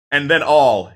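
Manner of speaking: ordinary talk, nothing marked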